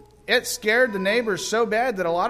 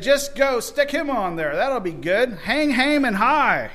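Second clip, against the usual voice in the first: king's order; 7 semitones higher, 6 dB louder than usual. Retold or imitated. King ordering Haman hanged.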